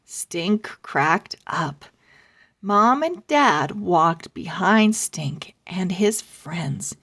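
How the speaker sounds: ordinary delivery, nothing marked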